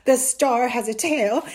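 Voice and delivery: loud, scoffing echo; scornful disbelief. A narrator's reading. Mother mocks son's star story, disbelieving. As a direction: list his claim as nonsense. Mother throws his words back.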